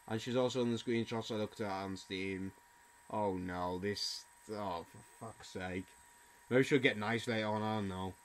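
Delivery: low, soft, defeated grumble; dejected grumbling. Real, not acted. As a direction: sink into quiet resignation. Dejected about meeting her again.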